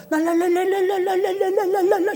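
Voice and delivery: high rapid 랄랄랄 babble with a laugh; 랄랄랄 trilled high and fast, laughing; laughing tongues babble. Retold or imitated. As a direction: rattle off 랄랄랄 joyfully, high. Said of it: "나도 ... 시작을 한 거야".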